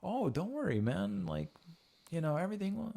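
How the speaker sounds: high-pitched soothing dad act; mock reassurance, pitched well up; lenient father. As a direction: play the easygoing dad letting it slide. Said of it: "You gotta be like Father A".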